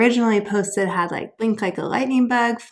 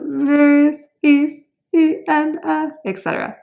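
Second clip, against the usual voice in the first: mock crying, higher and louder; 7 semitones higher, 4 dB louder than usual. Shown as a demonstration. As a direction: fake a silly sob through the chant. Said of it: "Let's do it in a crying voice".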